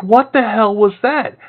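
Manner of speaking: high, slow, baffled yelp; mock shocked what-the-hell; startled outburst. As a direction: blurt it out, caught off guard. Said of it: "And I'm like".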